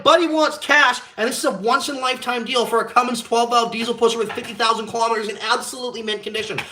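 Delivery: an exasperated customer pleading with the bank teller, loud. Retold or imitated. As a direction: rant your case at the teller.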